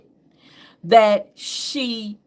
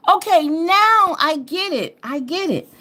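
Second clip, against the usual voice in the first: eureka moment; 8 semitones higher than usual. Retold or imitated. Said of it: "I was like".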